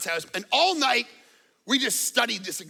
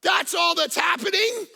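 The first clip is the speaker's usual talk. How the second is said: higher, louder insistent shouting; retold heated argument; 9 semitones higher, 5 dB louder than usual. Preacher retelling arguing with Sean, loud insistent shout. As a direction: shout him down with conviction. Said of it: "And I'm like".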